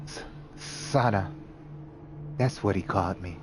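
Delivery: barely there, stumbling on the name; dazed scared girl. Acted. Frightened dazed girl answers.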